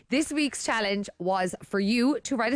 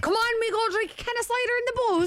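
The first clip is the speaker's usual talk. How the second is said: put-on high sing-song Cork accent; 14 semitones higher than usual. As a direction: Cork lad, up in pitch. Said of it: "Why did that come out Cork?".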